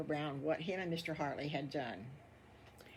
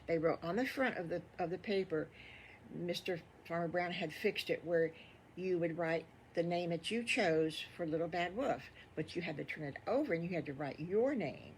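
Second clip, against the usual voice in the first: plain reading voice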